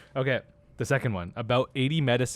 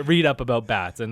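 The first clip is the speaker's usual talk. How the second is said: ordinary talk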